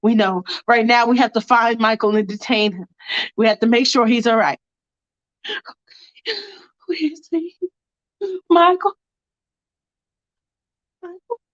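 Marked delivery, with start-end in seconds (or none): 5.5-11.4 hushed, high-pitched worried calling; anxious near-whisper rising into a call; anxious searching. Acted. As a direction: search for him, voice high and small. Searching for wounded Michael.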